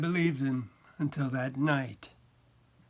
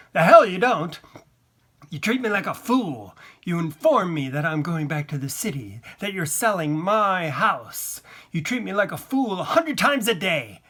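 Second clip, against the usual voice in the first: loud, heated retort; angry outburst; 4 semitones higher, 7 dB louder than usual. A narrator's reading. Husband telling wife she treats him like a fool, angry. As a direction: fire back indignantly, pitch jumping up.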